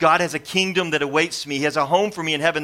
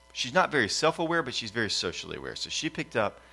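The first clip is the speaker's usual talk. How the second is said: usual speaking voice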